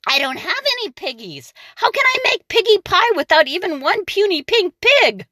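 high fretful witch complaint; witch wail. Acted. The witch lamenting no pigs, high and fretful. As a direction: whine like a frustrated witch. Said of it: "screeched Gritch".

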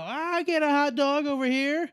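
acted shout across to dad; calling out. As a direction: pipe up over a crowd. Calls out for a hot dog.

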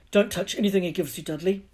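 fast, loud, sharp warning. Acted. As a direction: snap it fast at the boy. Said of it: "Uncle Vernon said sharply".

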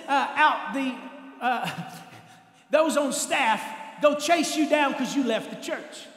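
ordinary delivery

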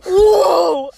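long, high, loud whoa; whoa shout. Retold or imitated. Reacting to the co-host's surprising line, shouted whoa.

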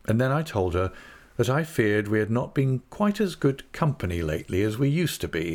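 plain reading voice

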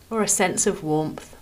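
calm, measured phrases with long pauses between them; guided meditation. Acted. Podcast host guiding listeners through a body scan, calm meditation voice. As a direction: ease each phrase gently downward, leaving room to rest.

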